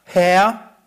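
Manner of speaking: loud, brief call for help; read-out cry. A narrator's reading. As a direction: cry out as the drowning man, narrated. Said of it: "schrie er und sprach". (laut vorgelesener Hilferuf)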